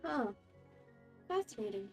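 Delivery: ordinary talk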